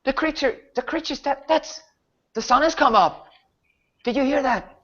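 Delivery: a guard losing his nerve in daylight; terrified guardsman. Acted. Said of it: "one of them says".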